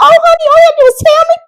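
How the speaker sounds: very high, loud, cooing stewardess voice; imitating a sugary stewardess; sweet stewardess. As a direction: sweetly reassure the scared passenger.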